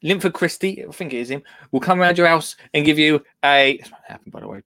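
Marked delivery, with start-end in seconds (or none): 3.8-4.6 murmured aside under breath; whispered aside. Real, not acted. Quiet aside.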